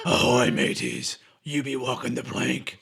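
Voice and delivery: slow low pirate growl. Pretend. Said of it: "Talk Like a Pirate Day".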